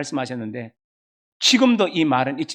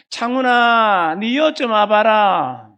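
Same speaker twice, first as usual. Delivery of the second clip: slow, drawn-out Gyeongsang call, higher and louder; Gyeongsang mother calling; 5 semitones higher, 4 dB louder, much slower than usual. Retold or imitated. Gyeongsang mother summoning her son, slow drawn-out call. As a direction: call your son over in Gyeongsang dialect, slowly. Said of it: "경상도 사람이니까 알죠? 이렇게 불렀어요".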